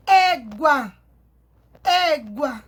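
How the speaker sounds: égua with a loud, long opening é; stress on É. Shown as a demonstration. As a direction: punch and stretch the é in égua. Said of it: "Sílaba tônica é o E".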